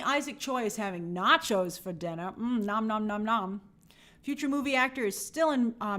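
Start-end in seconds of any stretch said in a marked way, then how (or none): 2.4-3.6 playful nom-nom eating noises; cartoon munching sounds; mock munching. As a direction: pretend to chew greedily on nachos. Viewer having nachos for dinner.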